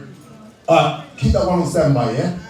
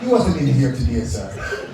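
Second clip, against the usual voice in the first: lower, deadpan denial, lightly voiced; mock Jamaican shopkeeper; 6 semitones lower, 3 dB quieter than usual. Host imitating a Jamaican shopkeeper who denies all. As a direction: play dumb with a straight face. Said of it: "Jamaicans go".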